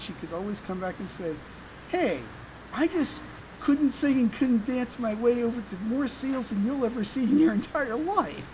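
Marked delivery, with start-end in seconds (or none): none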